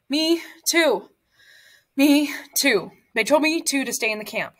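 breathless, gasping out the words; out of breath. Acted. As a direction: speak short of breath, panting lightly. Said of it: "He was out of breath".

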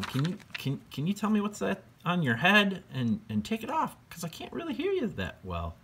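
very high, sing-song ghost voice; friendly ghost. Acted. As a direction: float it up high like a goofy ghost. Ghost asks George to remove helmet.

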